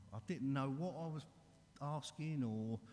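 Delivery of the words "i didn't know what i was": normal talking voice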